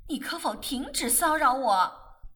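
loud exasperated demand; shouting. Acted. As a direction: shout at him to back off. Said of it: "玫瑰嚷".

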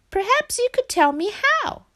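very high-pitched little frog; frog character. A narrator's reading. Frog asks Hare to teach.

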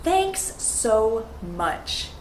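slow, careful teacher model; slow and clear demo; slow model phrase. Shown as a demonstration. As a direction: say it slowly for learners to copy. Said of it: "The first phrase or sentence is".

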